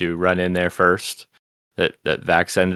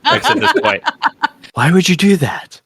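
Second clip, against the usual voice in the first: higher, laughing mock exasperation; imitating Laura; 10 semitones higher than usual. Laura scolding Travis over potion prices, higher mock exasperated. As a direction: throw it at him like Laura would, half laughing. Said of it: "it was totally Laura".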